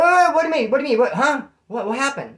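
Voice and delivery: loud, high, frantic questions; blurting out in alarm, voice jumping up; panicked disbelief. Retold or imitated. Caught off guard by cellie's news, frantic and loud.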